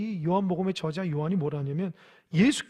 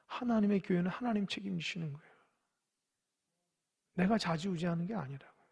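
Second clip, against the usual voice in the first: normal talking voice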